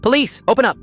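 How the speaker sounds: very high, loud command; police shout. Acted. Police at the door, loud command. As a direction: bark it at the door.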